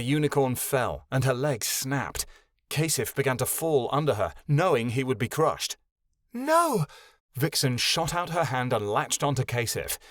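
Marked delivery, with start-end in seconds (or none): 6.3-6.8 sudden high cry; high cry of alarm. Acted. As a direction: shout it out, alarmed. Vixen cries out as Lavender falls.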